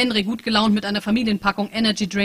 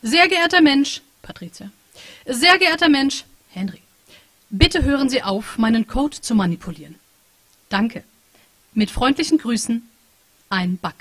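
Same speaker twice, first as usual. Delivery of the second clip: stiff polite letter; 3 semitones higher, 6 dB louder than usual. Acted. The bug writing politely to the humans, stiff and formal. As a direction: recite it like a stiff machine letter.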